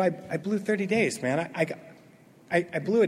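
soft, halting admission; hushed, hesitant owning-up; sheepish confession. Retold or imitated. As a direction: stammer it out quietly. Acts out sheepish confession to sponsor.

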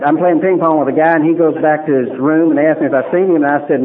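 ordinary talk

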